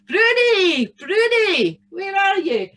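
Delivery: high, loud search call; loud calling shout. A narrator's reading. Parents out searching for their cow, shouting. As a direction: holler for a lost cow. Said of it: "they shouted for her".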